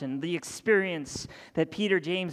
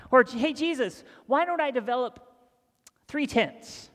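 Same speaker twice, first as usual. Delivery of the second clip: eager Peter; 6 semitones higher, 4 dB louder than usual. Retold or imitated. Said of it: "he says".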